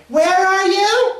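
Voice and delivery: piercing, drawn-out high holler; loud, high, stretched call. Acted. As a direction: yell slowly across the house. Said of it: "you call out".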